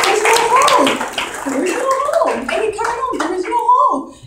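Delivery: acted high, loud cry of disbelief; astonished insistence. Astonished surgeon telling mother the hole is gone, loud disbelief.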